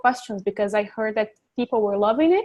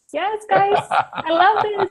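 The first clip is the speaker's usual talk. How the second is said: much higher, slowed, gushing delight; delighted cheer; 9 semitones higher, slower than usual. Real, not acted. Teacher cheering the girls in her class, high excited gush.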